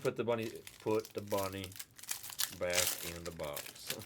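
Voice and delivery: mock southern twang; southern accent, low and lazy. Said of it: "it was with a southern accent".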